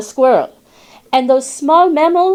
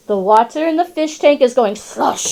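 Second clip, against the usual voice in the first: ordinary delivery, nothing marked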